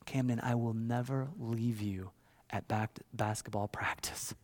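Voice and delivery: hushed, soft fatherly voice; tender promise. Retold or imitated. Father holds six-year-old's face, hushed tender promise. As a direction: promise it softly, close to the child.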